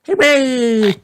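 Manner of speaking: high-pitched little cheer. A narrator's reading. Young rabbits applauding the music, cheering. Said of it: "cheer Richard and Rebecca".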